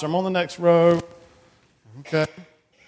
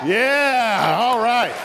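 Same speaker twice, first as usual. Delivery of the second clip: cheering approval; 4 semitones higher, much slower than usual. Real, not acted. Cheers the children's shout.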